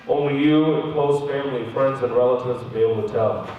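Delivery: low-pitched doctor voice; retold doctor. Doctor explaining outcome to patient, low doctor voice.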